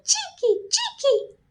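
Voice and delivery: very high, soft chittering scold; scolding swallows. A narrator's reading. Swallows scolding barn visitors, very high chitter. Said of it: "swoop down from their nests and scold".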